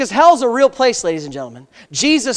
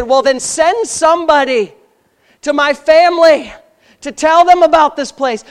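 impassioned plea; 6 semitones higher, 5 dB louder than usual. Retold or imitated. Rich man pleading from hell.

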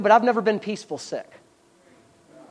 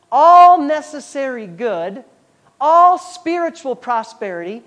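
emphatic reading; 3 semitones higher, 3 dB louder, slower than usual. Acted. Preacher reading scripture with emphasis.

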